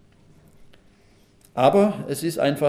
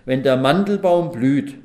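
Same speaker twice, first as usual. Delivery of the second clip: slow, loud repeat of a verse; measured, emphatic proclamation; scripture reading; 7 dB louder, slower than usual. Acted. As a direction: declaim the line deliberately, drawing it out.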